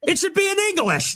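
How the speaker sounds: high mocking voice of an annoyed fan; mock whiny complainer. Mocking the fans who demand English, in a high whine. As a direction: whine it up high like a petty viewer.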